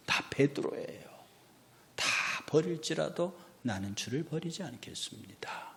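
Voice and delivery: hushed, breathy Peter vowing loyalty; whispered boast. Acted. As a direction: whisper the vow like a secret pledge. Peter's boast voiced softly.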